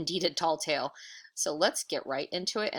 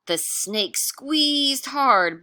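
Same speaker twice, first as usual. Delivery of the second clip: slow, higher, louder straining read; dramatic squeeze; 7 semitones higher, 6 dB louder, much slower than usual. A narrator's reading. Teacher building tension for kids, slow and strained. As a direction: squeeze the words out slowly.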